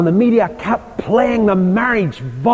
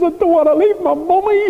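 mock tearful whine, higher pitched; a grown man sobbing for his mother, lightly acted; imitating a crying groom; 11 semitones higher than usual. Groom weeping on his wedding day, imitated high and tearful. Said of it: "And he cried".

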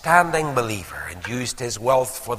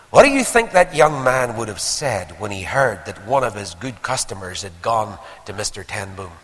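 ordinary talk

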